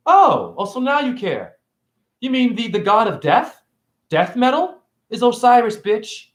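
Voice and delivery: high sarcastic jab; mocking. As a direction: needle him with a high sarcastic tone. Mocking back at the anarchist.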